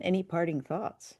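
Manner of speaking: ordinary talk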